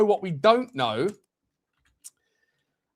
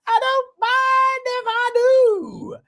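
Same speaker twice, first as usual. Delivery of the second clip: hammy catchphrase; slower than usual. Acted. Repeat, even higher drawn out.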